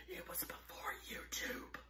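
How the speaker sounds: breathy whispered aside. Real, not acted. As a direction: toss it off as a secret aside.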